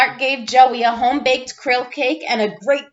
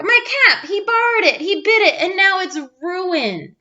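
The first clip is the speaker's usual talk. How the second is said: much higher, near tears complaint; upset whining; 7 semitones higher than usual. Acted. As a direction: wail about the ruined cap. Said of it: "cried Clark".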